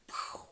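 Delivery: whooshing blast noise; voiceless "pwhoo" of ground blowing up; imitated explosion. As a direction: make an explosion sound with breath. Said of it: "the ground beneath me just".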